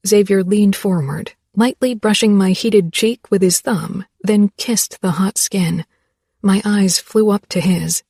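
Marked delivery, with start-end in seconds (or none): none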